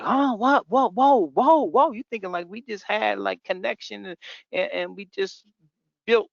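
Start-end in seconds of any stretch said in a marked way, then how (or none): 0.0-1.9 high, loud baffled huh and whoa; stunned disbelief. Retold or imitated. Agent stunned when the customer suddenly backs out, high loud disbelief. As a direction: caught completely off guard, voice jumps up.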